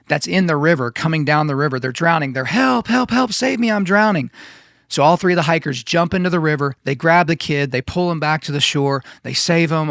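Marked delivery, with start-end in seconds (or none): 2.4-4.3 put-on higher, panicked child's call for help; mock cries for help. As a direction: act out a drowning kid crying for help. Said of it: "the screams and the cries of a child".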